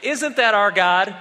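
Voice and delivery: delighted exclamation. Real, not acted. Delighted exclamation after the story.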